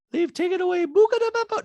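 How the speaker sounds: very high put-on voice tripping over the words; fumbled Dorit quote. Podcaster stumbling while quoting Dorit, high put-on voice. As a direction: garble the line in a squeaky Dorit voice.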